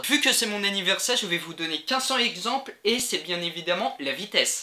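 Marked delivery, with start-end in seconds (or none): none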